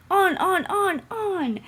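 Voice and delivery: high, loud jeering chant; mocking trucks. A narrator's reading. Trucks jeer at James as they push him. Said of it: "laughed the trucks".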